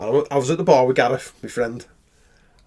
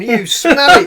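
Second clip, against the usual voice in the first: high, louder, giggling accusation; laughing mock insult; 11 semitones higher, 8 dB louder, much slower than usual. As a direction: blame him while cracking up.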